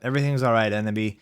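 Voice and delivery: ordinary delivery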